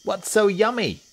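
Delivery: very high, loud hippo question; Humpy Rumpy. A narrator's reading. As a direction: ask in a high hippo squeal. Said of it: "asked Humpy Rumpy".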